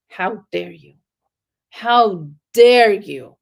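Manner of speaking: rising outrage, second one loud and drawn out; indignant. Retold or imitated. Parent thinking back at a yelling child, growing indignant. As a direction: let the hurt pride build into a slow loud how dare you. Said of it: "I'm thinking".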